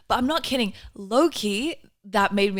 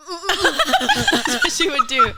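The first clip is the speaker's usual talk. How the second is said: laughing; 10 semitones higher, 8 dB louder, slower than usual. Real, not acted. Laughing about her mum.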